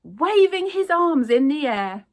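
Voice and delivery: ordinary delivery, nothing marked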